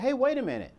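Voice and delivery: high-pitched interjection, as if just remembering; sudden realisation. Retold or imitated. Someone else in the meeting cuts in, realising. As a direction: cut in with a sudden thought.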